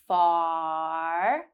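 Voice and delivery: "Far" with a long drawn-out ah; held ah. Shown as a demonstration. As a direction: stretch the ah of "Far". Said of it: "ah sound at the end".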